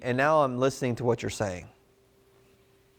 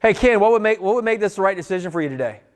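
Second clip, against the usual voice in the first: pushy rushed version; 4 semitones higher than usual. Shown as a demonstration. The wrong way to ask Ken, rushed and pushy. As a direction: rattle it off too eagerly. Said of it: "I can't go".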